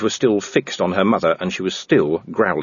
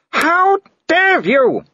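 very high, slow, louder scream; furious scream; 4 dB louder, much slower than usual. A narrator's reading. Seraphine screaming at Captain Tantony. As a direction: scream it in fury. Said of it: "screamed Seraphine".

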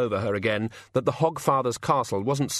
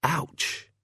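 a sharp, higher pained "ouch"; 6 semitones higher than usual. Acted. Hungover god wakes in pain.

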